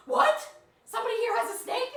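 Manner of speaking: high, loud gasped disbelief; shocked excitement. Retold or imitated. Hearing a snake is at the party, shrieking in excitement. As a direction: squeal it like you can't believe your luck.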